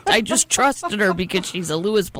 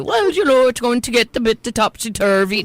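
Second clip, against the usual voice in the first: high, lilting Scandinavian captain's announcement; hammed-up singsong drunk, pitched up; drunk minimizer; 4 semitones higher than usual. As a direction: play down danger like a tipsy Scandinavian captain. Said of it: "like a drunk would do".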